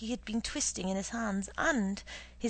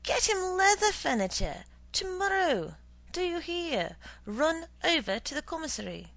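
commanding; 8 semitones higher, 7 dB louder than usual. Acted. Boss ordering subordinate.

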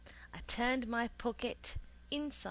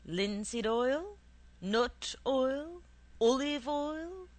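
slow, louder questions climbing higher; impatient; 5 semitones higher, 5 dB louder, slower than usual. A narrator's reading. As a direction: stretch each option with impatience. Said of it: "With a frown of impatience".

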